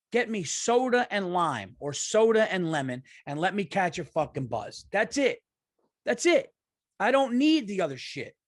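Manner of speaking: getting mad. Real, not acted. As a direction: rant like it's a real grievance, voice up. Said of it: "I don't know why I'm getting mad".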